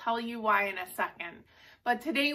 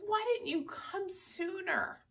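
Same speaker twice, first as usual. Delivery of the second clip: higher, softer, slow wounded question; hurt reproach; 8 semitones higher, 6 dB quieter than usual. Retold or imitated. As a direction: ask it like a sister let down. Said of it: "She's like".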